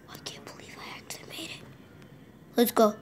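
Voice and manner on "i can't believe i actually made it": fast, low whispered disbelief; excited whisper. Real, not acted. Surprised at making it, fast whisper. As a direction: hush it out quickly. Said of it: "excited whisper".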